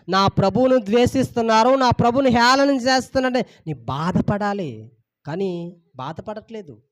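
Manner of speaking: ordinary talk, nothing marked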